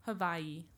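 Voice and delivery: Hawaii said with a V, "ha-VAI-ee"; native pronunciation of Hawaii. Shown as a demonstration. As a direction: say Hawaii with a V sound in place of W. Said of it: "That's how it's pronounced".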